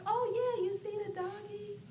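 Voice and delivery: high, bright mock parent talk; mother imitating herself talking to her son; singsong parent. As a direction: coo it like a parent to a toddler. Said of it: "So you're like".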